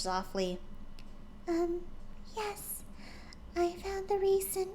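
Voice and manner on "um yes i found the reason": high, much softer hesitant voice; shy admission. Acted. Fluttershy admitting to curious friends, timid and soft. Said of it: "admitted slowly".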